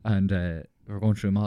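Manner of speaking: normal talking voice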